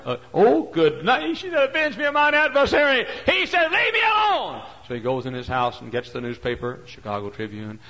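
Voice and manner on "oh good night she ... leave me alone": high, loud exasperated cries; hammed-up shouting exchange. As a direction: shout the back-and-forth. Judge and widow trade shouts.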